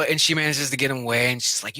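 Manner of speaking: ordinary talk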